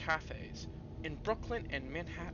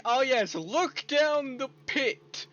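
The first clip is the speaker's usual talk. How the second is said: high, slow, sarcastic eagerness; hammed-up sarcastic cheer; mock eager; 9 semitones higher, 11 dB louder, much slower than usual. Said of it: "It's like".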